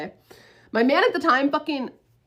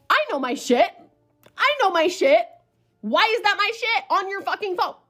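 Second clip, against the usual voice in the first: high, rising disbelieving outburst; crashing out; 7 semitones higher, 3 dB louder than usual. Retold or imitated. Storyteller seeing a familiar item in the photo, crashing out. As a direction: replay an inner meltdown, pitched up and urgent. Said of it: "mentally, I was fucking crashing out".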